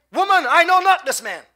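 high, loud protest; indignant denial. Acted. Peter denies knowing Jesus to a maid, loud indignant denial. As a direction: deny it forcefully, pitched high.

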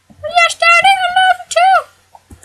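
squeaky son. Acted. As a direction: coo it like a doting little boy. High son voice replying.

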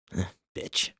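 hushed private jab; muttered to himself. A narrator's reading. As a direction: mutter the insult under your breath. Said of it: "he mutters to himself".

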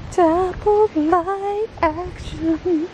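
slow, high, drawn-out echo; playful echo. Pretend. Echoing a dappled light suggestion while filming, playful drawl. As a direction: stretch each word up high, playfully. Echoes the suggestion as showy cue.

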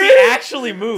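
high, loud, laughing outburst; real high-pitched yell of amusement; excited shout. Real, not acted. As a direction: shout it through laughter, amazed. Startled friend, everyone laughing.

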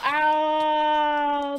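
long, loud "ow"; drawn-out yelp of pain. Pretend. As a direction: cry out as if hurt, holding it long. Falls and cries out in pain.

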